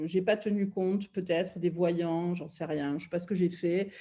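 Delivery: usual speaking voice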